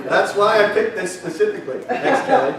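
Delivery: real laughter running through the words; laughing. Real, not acted. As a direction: laugh it out, amused at the wife's comment. Laughing about wife's remark.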